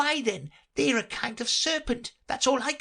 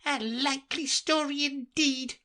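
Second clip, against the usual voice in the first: contempt. Acted. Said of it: "in a tone of the deepest contempt".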